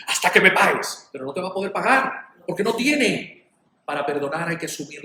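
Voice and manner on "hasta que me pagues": louder, insistent demand; acted loud demand; demanding creditor. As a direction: push it out forcefully like someone owed money. Acting the unforgiving creditor.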